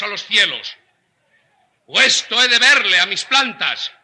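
rising to a commanding, loud call to arms; rally the troops, voice climbing high; rousing battle speech. Acted. (arenga enardecida)

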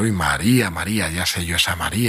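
low, wry mom who sees through it; lightly voiced skeptical mother; knowing mother. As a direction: drop the pitch, knowing. Knowing mother doubts the girl.

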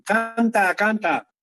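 put-on high childlike pleading; mild mock little voices; children begging. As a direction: imitate kids asking for a song. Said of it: "siempre me dicen".

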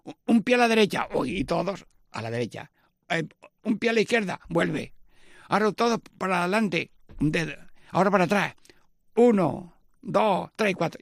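rally the family through the dance; cheerful step-calling; dance rehearsal call. Shown as a demonstration. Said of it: "Venga, ensayo".

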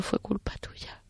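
softly whispered, slow and gentle; hushed, slow whisper of comfort. Retold or imitated. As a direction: whisper it like a secret absolution. Priest's words retold intimately.

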